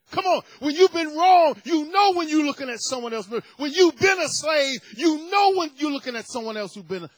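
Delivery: high, loud, shouted gospel fervour; hallelujah-shouting preacher; preaching at full force. Acted. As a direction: lift the room, climbing to a holler. Preacher hammering the point.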